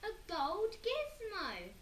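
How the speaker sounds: high, slow excited cry; thrilled. Acted. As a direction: marvel at it. Peter thrilled.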